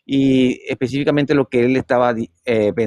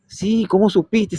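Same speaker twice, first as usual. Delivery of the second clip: much higher, drawn-out amazed voice; retold astonishment; 9 semitones higher, slower than usual. Brother astonished his sibling knew of the visit, amazed. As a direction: blurt it amazed, pitch leaping up.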